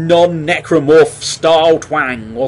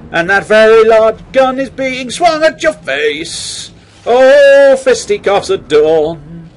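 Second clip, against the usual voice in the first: high, loud taunting game voice; voicing the game; 8 semitones higher, 5 dB louder than usual. As the game taunting the player, high, loud and brash. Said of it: "the game will emphasise ... by going".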